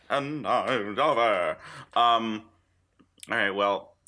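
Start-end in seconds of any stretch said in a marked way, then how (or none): 0.1-1.6 slow, grand Javert, dragged out; mock dramatic Javert. Co-host hamming up his Les Mis role, slow and grand. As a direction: announce yourself like the stage inspector.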